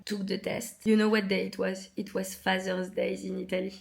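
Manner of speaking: ordinary delivery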